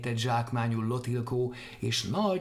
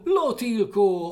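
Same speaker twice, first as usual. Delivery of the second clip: louder, slow, high Lotilkó; shouting after; 10 semitones higher, 8 dB louder, slower than usual. Acted. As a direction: bellow Lotilkó after him. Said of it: "kiabált utána". (Lotilkó hangosan, lassan, magasan kiáltva)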